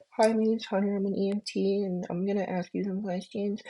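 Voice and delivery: soft, meek self-introduction with no confidence; timid intro. Shown as a demonstration. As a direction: mumble your intro like you don't believe in yourself. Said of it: "dude, you lost 'em".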